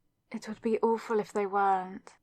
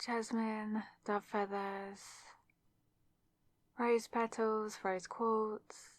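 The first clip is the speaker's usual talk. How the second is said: much softer, ticking items off to herself; soft murmur; 7 dB quieter, slower than usual. Acted. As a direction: murmur the list quietly. Murmuring through her checklist.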